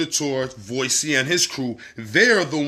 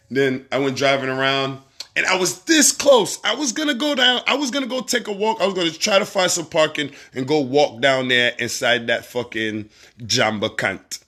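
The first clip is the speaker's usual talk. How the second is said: ordinary talk, nothing marked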